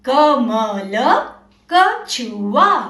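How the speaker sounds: very slow, drawn-out words for repeating; slow read-along. Shown as a demonstration. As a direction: stretch each word so kids read along. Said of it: "मेरे साथ साथ आप भी बोलना।".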